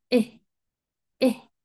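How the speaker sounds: brief "E" blending i and e; short I sound. Shown as a demonstration. Teacher explaining the vowel mix, short "E" between i and e. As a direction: open as for "E" but say a short sound halfway to i. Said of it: "abres tu boca como que vas a decir una E".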